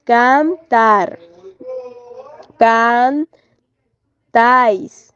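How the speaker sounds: "Cantar, cantáis" split slowly, TAR and TÁIS stressed; "Cantar, cantáis" syllable by syllable with long pauses; stress on last syllable. Shown as a demonstration. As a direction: lean on the endings of "Cantar, cantáis". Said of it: "el acento está en la última sílaba".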